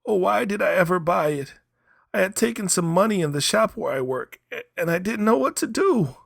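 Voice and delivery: high, loud pleading; desperate young man. Acted. Young man at Kiave's feet, high and loud pleading. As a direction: beg him to buy it, voice high.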